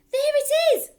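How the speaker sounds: very high, loud shout; excited cry. Acted. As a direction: brother spots the voice. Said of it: "cried the brother".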